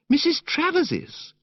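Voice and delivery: put-on high, breathless girlish gush, slowly savoured; gushing, dreamy young woman. Narrator voicing Madeline to Bertie in the park, high dreamy gush. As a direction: coo it like a sentimental girl.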